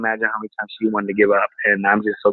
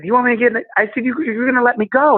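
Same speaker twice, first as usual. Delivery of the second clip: high, incredulous questions; disbelieving; 12 semitones higher, faster than usual. Retold or imitated. To the cop letting him go, high disbelief. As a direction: sound stunned at the offer.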